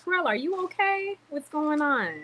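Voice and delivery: mock sweet greeting. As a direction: coo at Terrell like a sugary friend. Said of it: "there be like".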